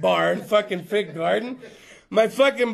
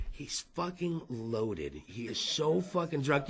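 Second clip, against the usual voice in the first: slow, much softer conspiratorial aside; slow hushed ribbing aside, much lower; muttered aside; 8 semitones lower, 13 dB quieter, slower than usual. Acted. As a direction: drop it under your breath to the crowd. Aside about drunk audience member.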